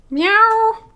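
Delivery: high, loud imitated meow; a loud high meow aimed at the cat. Meowing at the cat who got the final say, loud high meow. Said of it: "I shouldn't be meowing at you".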